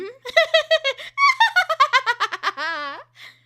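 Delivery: put-on high-pitched child giggle; bright little laugh. Shown as a demonstration. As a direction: giggle like a delighted kid.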